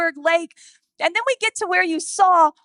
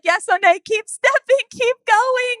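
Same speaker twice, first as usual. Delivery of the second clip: higher, louder encouraging call; cheering on; 7 semitones higher, 5 dB louder than usual. Retold or imitated. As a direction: spur her on up the path. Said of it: "We're like".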